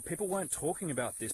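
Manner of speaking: ordinary delivery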